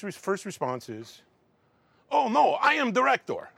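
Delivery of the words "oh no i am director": put-on high, loud strange foreign accent; imitating a thick odd accent, indignant; Tommy's odd accent. Tommy insisting he is the director, in his strange accent. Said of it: "with the craziest accent that you have ever heard".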